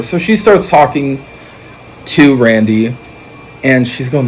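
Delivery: normal talking voice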